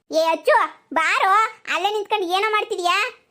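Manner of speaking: impatient call from a distance. Acted. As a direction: shout to him to come over. Calling to him from afar.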